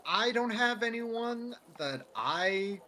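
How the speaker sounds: ordinary talk, nothing marked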